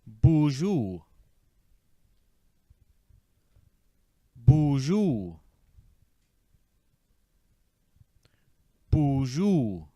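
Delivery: "Bonjour" as boozhoo, falling at the end; "Bonjour" said boo-zhoo, stress first; whole word drill. Shown as a demonstration. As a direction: model "Bonjour" for the learner to repeat. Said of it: "now let's try the whole word".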